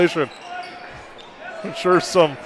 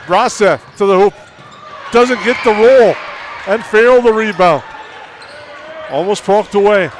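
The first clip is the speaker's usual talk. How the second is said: louder, higher, thrilled call; excited play-by-play; 4 semitones higher, 6 dB louder than usual. Real, not acted. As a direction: call the scramble with rising excitement. Rising excitement on a scramble.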